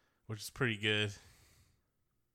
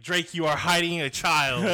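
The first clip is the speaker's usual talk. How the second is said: loud dramatic diss; mock-grand accusation; 5 semitones higher, 10 dB louder than usual. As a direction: proclaim it like a diss track. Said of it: "he's like".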